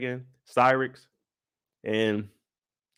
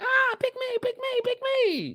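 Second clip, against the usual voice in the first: much higher, thin pleading falsetto; pleading women; 3 dB quieter than usual. Retold or imitated. Voicing the trapped women, high pleading falsetto. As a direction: beg like trapped women reaching out.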